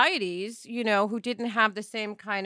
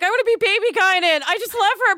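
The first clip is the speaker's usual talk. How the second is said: gushing fan; 10 semitones higher, 6 dB louder, faster than usual. Retold or imitated. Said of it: "I'd be like".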